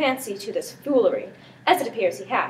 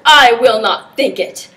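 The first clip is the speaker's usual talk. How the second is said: much louder flat denial; loud refusal; 12 dB louder than usual. Acted. Prince refusing to believe the slander, loud. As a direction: slam the door on the idea.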